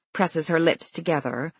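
usual speaking voice

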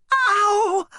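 sharp high yelp of pain. Acted. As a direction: yelp as the knuckles crack. Punch breaks her fingers.

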